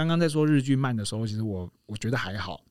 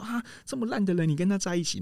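high-pitched mock disbelief; retold appalled surprise; 8 semitones higher than usual. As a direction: imitate a husband aghast at his wife. Retold husband appalled at wife's friend. (模仿丈夫惊讶又嫌弃地高声质问)

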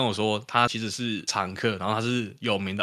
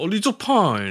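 much higher, nagging Taiwanese taunt from a rude customer; mocking Taiwanese; 10 semitones higher, 4 dB louder than usual. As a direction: play the customer needling in Taiwanese, high and sing-song. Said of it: "然后讲台语说".

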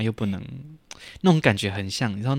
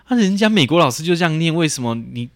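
louder, retold protest against the teacher; imitating her younger self's indignant outburst; indignant complaint; 5 semitones higher, 8 dB louder than usual. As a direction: replay the old frustration, loud and aggrieved. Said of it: "我就会有点不平".

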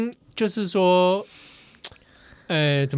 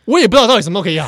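blunt clerk reply; 3 semitones higher, 6 dB louder, much faster than usual. Retold or imitated. Retold clerk's blunt answer.

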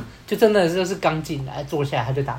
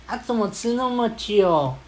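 put-on high, slow, clingy girl's voice, louder at the end; mock whiny girlfriend; 6 semitones higher, 3 dB louder than usual. Girl on the phone whining to the caller why dinner took so long. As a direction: coo it like a needy girlfriend.

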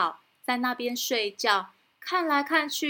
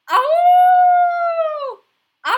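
wolf howl; 13 dB louder, much faster than usual. Acted. The real wolf howls.

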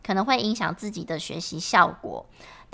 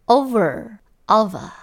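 British pronunciation; 5 dB louder, much slower than usual. Shown as a demonstration. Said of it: "把R的卷舌音去掉".

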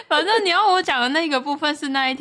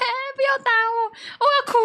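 imitated child crying; 12 semitones higher, much faster than usual. Little girl crying during a fight with her sister, mock wail. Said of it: "我就开哭".

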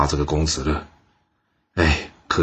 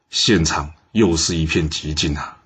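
usual speaking voice

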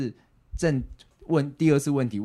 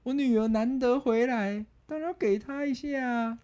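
much higher, slow proud father voice; imitating dad; 13 semitones higher, slower than usual. Daughter imitating dad welcoming her home, slow and high. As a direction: play the doting dad boasting. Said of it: "然后就说".

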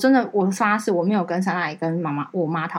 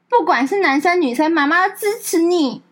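higher, slow, tender mother; a higher, slowed, tender mother's promise; mom's supportive voice; 9 semitones higher, 4 dB louder than usual. Retold or imitated. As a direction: say it like a mom reassuring her child. Mother replies in group chat.

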